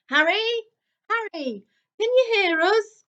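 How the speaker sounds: high, loud call through a wall; boy calling for Harry through boards. A narrator's reading. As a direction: shout to someone on the other side.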